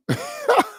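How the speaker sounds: very high, drawn-out cackle; mocking laughter. Host jeers at the defeated comrades, mocking laughter. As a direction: burst into high staccato laughing.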